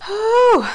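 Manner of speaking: high, loud blown-out whew; big bracing sigh; nervous whew. Real, not acted. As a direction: exhale hard to steady yourself. Bracing before the final payment.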